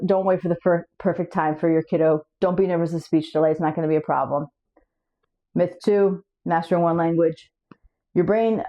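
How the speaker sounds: usual speaking voice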